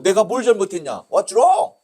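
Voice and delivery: high-pitched outraged questioning; acted angry protest; indignant challenge. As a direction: demand an answer, pitch shooting up at the end. Teacher protests visa rejection.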